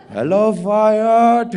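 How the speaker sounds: put-on drawn-out, high and loud Indian accent; hammed-up Indian doctor greeting. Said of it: "to do the Indian accent".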